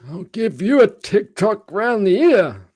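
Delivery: high, slow, creaky grandpa; cranky old man voice. Pretend. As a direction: play a doddering old geezer. Mocking old people scolding kids.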